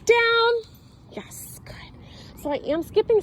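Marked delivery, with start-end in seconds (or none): 0.1-0.5 high, loud "Down." barked at the dog; sharp command. Real, not acted. Trainer barking Down at her Frenchie, loud and high. 1.1-1.8 whispered praise. Real, not acted. Quiet marker for dog.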